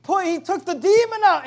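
high, loud whooping cheer; giddy shout of victory; excited whoop. Retold or imitated. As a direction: cheer like a thrilled disciple. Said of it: "They're all excited".

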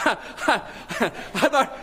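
high, real laugh breaking into the words; can't help laughing at it; laughing in disbelief. Real, not acted. Pastor laughing at the gold chain story.

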